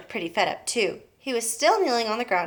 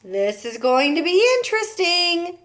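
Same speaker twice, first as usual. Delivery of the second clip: amused grin; 8 semitones higher, 4 dB louder than usual. A narrator's reading. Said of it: "still grinning".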